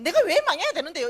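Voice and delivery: loud, high, indignant demand; worked-up anger. Retold or imitated. Speaker challenging God over her failure, fired-up and angry. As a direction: demand an answer, hotly aggrieved. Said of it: "이게 이제 열받는 거죠".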